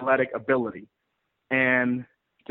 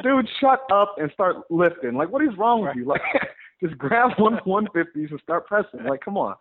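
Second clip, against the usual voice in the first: mock exasperation; 6 semitones higher than usual. Retold scolding of tag partner.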